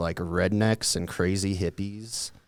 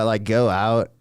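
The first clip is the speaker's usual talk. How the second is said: usual speaking voice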